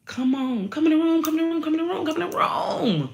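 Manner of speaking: high, loud eager calling, come in the room; June calling you in. Pretend. June the month calling listeners in, eager and high. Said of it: "June is telling you".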